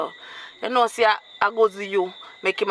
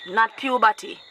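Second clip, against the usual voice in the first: ordinary delivery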